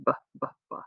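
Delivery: "b" as three quick separate soft pops; isolated consonant. Shown as a demonstration. Teacher reminding children of the target sound, isolated consonant. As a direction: repeat just the "b" sound, softly, with gaps. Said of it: "where you hear the sound".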